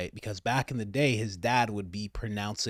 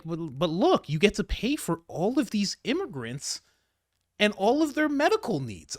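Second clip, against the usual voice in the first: higher, pleading, hammed-up lecture; mock a smug tax fan pleading higher and higher; mock preachy voice; 8 semitones higher than usual. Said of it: "And they're like".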